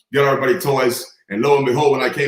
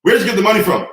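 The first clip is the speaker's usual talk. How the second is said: stern father; 7 semitones higher, 4 dB louder, faster than usual. Retold or imitated. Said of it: "He said".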